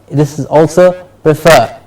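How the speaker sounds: ordinary delivery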